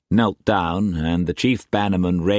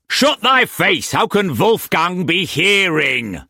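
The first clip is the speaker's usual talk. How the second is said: high, loud, irate silencing; angry rebuke; 12 semitones higher, 4 dB louder than usual. A narrator's reading. Brock shutting up Roach so Mauss can hear, angry rebuke. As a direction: explode at the interrupter, pitched right up.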